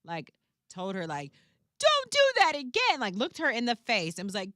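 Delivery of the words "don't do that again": high, loud mock child yell; imitated toddler yell. Kid told his mother off, imitated yell. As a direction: mimic a little kid yelling at mom. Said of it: "He yelled at his mother".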